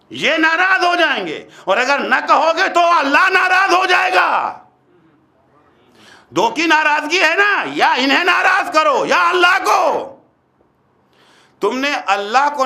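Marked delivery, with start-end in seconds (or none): 0.1-1.5 raised, high-pitched retort; indignant challenge. Acted. Preacher throwing the objection back at listeners, indignant challenge. 1.7-9.8 high, impassioned sermon delivery; forceful preaching. Real, not acted. Preacher warning listeners of God's displeasure, forceful preaching.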